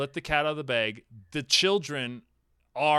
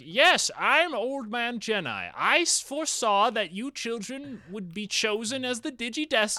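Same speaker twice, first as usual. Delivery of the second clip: put-on wise old man voice; 8 semitones higher than usual. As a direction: play the mystic old guide. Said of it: "he's like".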